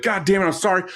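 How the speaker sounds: high-pitched mock frazzled caller; flustered apology. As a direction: imitate someone exasperated and apologizing. Imitates stressed, apologetic caller.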